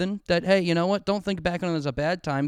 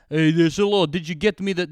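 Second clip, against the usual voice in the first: louder mock Russian accent villain; 5 dB louder than usual. Movie villain asking his henchman, in mock Russian accent. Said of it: "This is Russian".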